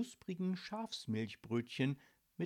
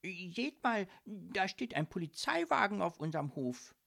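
higher, mildly excited character voice; a touch of breaking news, pitched up; 7 semitones higher than usual. A narrator's reading. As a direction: point it out in some alarm. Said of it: "zeigte gleichzeitig aufgeregt".

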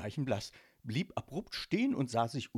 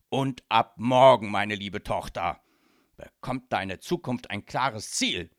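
irritable mafia boss, erupting loudly then resolute; displeased godfather; 8 dB louder than usual. Acted. Godfather condemns goldfish, decides daughter's future, irritable and commanding. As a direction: order them about with loud disdain.

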